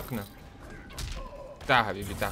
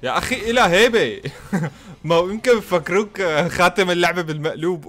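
laughing; 4 semitones higher, 5 dB louder than usual. Real, not acted. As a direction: talk through real laughter, loud and high. Laughing at the opponent. (كلام مع ضحك حقيقي)

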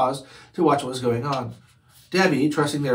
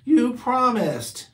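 put-on whiny kids; 7 semitones higher, slower than usual. Kids whining at mother.

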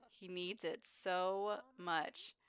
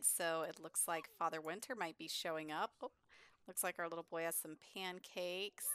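ordinary delivery

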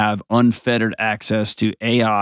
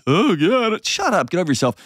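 high, dismissive eye-roll voice; mock squeamish; 8 semitones higher than usual. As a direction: parody someone acting grossed out. Mocking squeamish reaction to periods.

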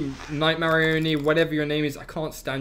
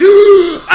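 loud high gasp of fright; sharp scared gasp; 14 semitones higher, 14 dB louder than usual. Real, not acted. Player caught by an animatronic, loud frightened gasp. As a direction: suck in air as it hits. Jumpscare in horror game.

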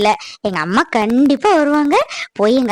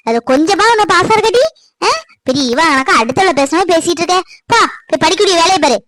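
loud, higher, exasperated scolding; 4 semitones higher, 3 dB louder than usual. Acted. Mother orders Nihi off to her studies, angrily loud. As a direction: send her off to study, fed up.

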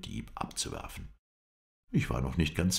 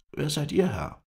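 high, louder character voice; pitched-up voice for the Kurd; Kurdish stranger; 13 semitones higher, 5 dB louder than usual. A narrator's reading. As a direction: question back in a raised, high voice. Kurd returns the question.

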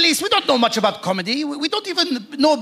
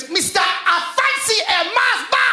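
high, drawn-out exaggerated name announcement; over-careful foreign-name announcement; mock newsreader; 10 semitones higher, slower than usual. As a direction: pronounce the name slowly and grandly, pitched way up. Mock newsreader announcing silly names.

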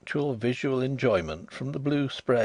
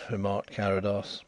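ordinary delivery

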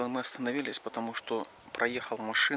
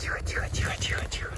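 hushing whisper; faster than usual. Real, not acted. Shushing near horses.

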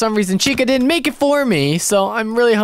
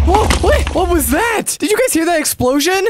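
startled; 7 semitones higher than usual. Acted. Friend startled by an explosion down the street, alarmed. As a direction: jump at the sudden blast.

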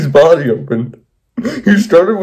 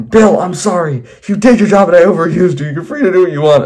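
higher, hurried mock pleading; pleading negotiation; 5 semitones higher than usual. Henry bargains with Bill for his life, acted pleading. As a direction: plead your way out of it. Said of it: "Henry tried to negotiate himself out".

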